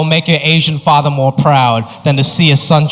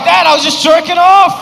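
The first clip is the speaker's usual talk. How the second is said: high, loud panicked blurt; a teen caught by his dad, shouting in panic; 12 semitones higher, 3 dB louder than usual. Retold or imitated. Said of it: "I was like".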